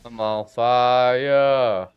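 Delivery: deadpan slow-motion line, as if savouring the burn; slow dramatic drawl. Real, not acted. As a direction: stretch every word out very slowly. Character burning in game.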